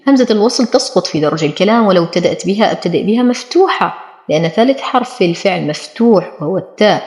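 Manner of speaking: usual speaking voice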